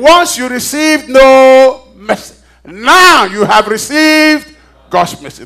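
slow, loud, high proclamation; fiery preaching. Real, not acted. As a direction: proclaim it with drawn-out words to rouse the church. Preaches verse at full force.

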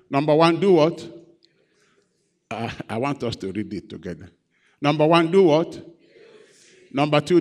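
loud, punchy preacher calling out to the crowd; rousing pulpit call and response; preaching call and response. Acted. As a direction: drive each question at the congregation.

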